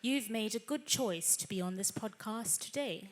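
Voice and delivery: posh Queen's English RP accent. Shown as a demonstration. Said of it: "R P would be".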